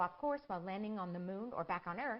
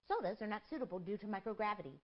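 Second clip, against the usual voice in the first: usual speaking voice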